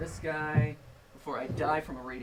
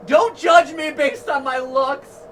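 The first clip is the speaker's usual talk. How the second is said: loud, higher, hurt robot protest; indignant robot rant; 5 semitones higher, 6 dB louder than usual. Acted. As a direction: proclaim robot pride, hammed up. Indignant robot ranting at Olivia.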